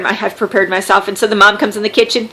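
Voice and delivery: ordinary talk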